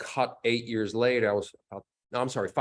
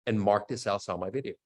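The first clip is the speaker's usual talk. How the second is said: ordinary delivery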